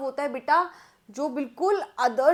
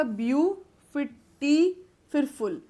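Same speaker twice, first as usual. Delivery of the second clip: View, टी and फुल each set apart as a syllable; counting out syllables. Shown as a demonstration. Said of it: "तीन syllable हो गए".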